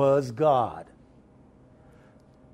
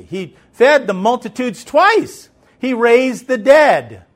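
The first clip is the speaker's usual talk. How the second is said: higher, louder, slow preaching climax; preaching at full force; 8 semitones higher, 8 dB louder, slower than usual. Acted. Pastor building to a climax before the congregation, loud and slow. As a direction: ring out each miracle.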